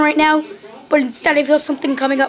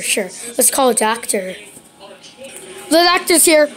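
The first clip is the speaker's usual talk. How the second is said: ordinary talk, nothing marked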